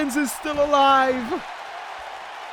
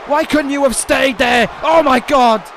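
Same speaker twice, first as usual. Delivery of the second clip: loud exasperation; 4 dB louder than usual. Real, not acted. Disbelief after the interference.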